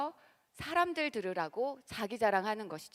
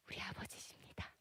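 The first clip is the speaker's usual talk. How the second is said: barely voiced whisper; 17 dB quieter than usual. Real, not acted. Preacher whispers that God is our Father: hushed. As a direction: breathe it out as a secret.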